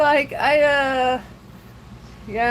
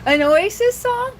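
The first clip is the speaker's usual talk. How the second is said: surprised question; 9 semitones higher, 4 dB louder than usual. Real, not acted. Reacting to chat surprised.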